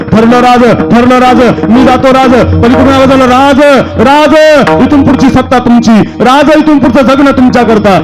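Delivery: high-pitched, full-force preaching; rally the men with ringing repeated cries; fervent battle oratory. Acted.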